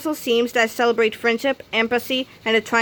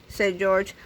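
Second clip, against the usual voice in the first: ordinary delivery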